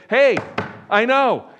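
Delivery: high, loud interjection; imagined eager shout to be heard; John speaking up. Acted. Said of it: "he'd stand up and say".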